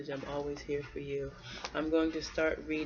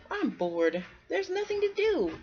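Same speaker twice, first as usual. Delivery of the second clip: put-on whiny kid voice; 14 semitones higher, 4 dB louder than usual. Child squirrel complains to mother.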